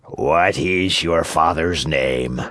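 slow, low showman's voice; big slow puppet-master, questioning; Fire Eater. A narrator's reading. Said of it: "Fire Eater called Pinocchio aside and asked him".

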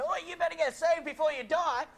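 high, loud mock pressuring shout; high-pressure urging. Missionary pressuring a dying man to get saved, mock pushy shout. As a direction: imitate a pushy preacher at full volume. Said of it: "putting too much pressure on him".

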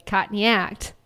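"caught in the act" with Australian dropped R; put-on Australian vowels on "caught in the act"; Australian accent. Shown as a demonstration. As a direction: say "caught in the act" like an amateur Aussie. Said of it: "And when you say".

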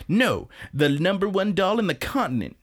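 high-pitched, grandly insistent boast; mock boastful correction. Retelling Iris's dream of being top doll, mock boastful. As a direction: proclaim it as a hammed-up brag.

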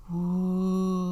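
long, drawn-out whoosh fading into the sh; sustained, level whoosh; whooshing sound effect. A narrator's reading. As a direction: stretch it like a rush of air. Narrator imitates noises in quiet house.

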